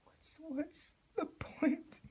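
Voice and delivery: very high, slow whispered despair. A narrator's reading. Twilight alone among the dead, whispering hopelessly. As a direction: barely breathe it, hopeless. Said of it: "she asked herself".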